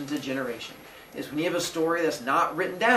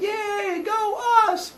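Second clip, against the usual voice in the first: silly cheer; much slower than usual. Retold or imitated. Warriors cheering themselves.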